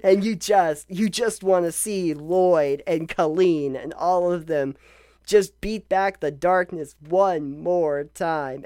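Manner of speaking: ordinary delivery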